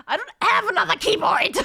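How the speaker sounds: frustrated real yell; exasperated shout. Real, not acted. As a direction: yell back at chat in disbelief. Answering chat's advice, exasperated.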